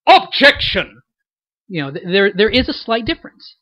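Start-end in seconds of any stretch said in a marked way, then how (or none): 0.1-1.0 loud yell with OB hit hard in Objection; Edgeworth. Acted. Voice actor demonstrating the rival character's objection, loud yell stressed on the first syllable. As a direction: bellow Objection in a put-on voice, punching the first syllable. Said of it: "and then when Edgeworth goes".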